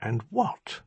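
quiet, controlled question. A narrator's reading. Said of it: "he said very quietly".

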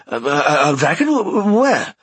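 groggy stammering, just woken; dazed. Acted. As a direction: stutter it out half asleep. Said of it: "came Dothan's groggy voice".

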